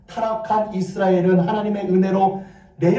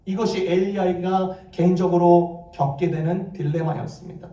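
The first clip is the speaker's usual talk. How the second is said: ordinary talk, nothing marked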